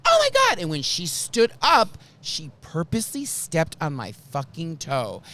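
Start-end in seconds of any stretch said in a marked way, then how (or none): 0.1-0.6 imitating a squeal, much higher and louder; shrill indignant gasp. Karen standing up in outrage, a much higher mock squeal. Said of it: "And she goes".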